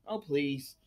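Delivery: "Oh, please" again in a low mock voice, unlike your own; mock the idea in a put-on tone; a put-on, low, scoffing "Oh, please". Real, not acted. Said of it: "repeated dismissal".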